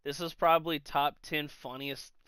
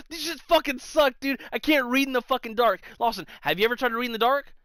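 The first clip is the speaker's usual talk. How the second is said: imitating a raised-voice rant, high and rushed; angry ranting; 6 semitones higher, 4 dB louder, faster than usual. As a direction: complain heatedly as the fed-up mailman. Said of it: "he's fucking raging".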